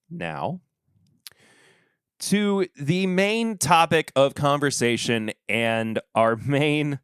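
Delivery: ordinary talk, nothing marked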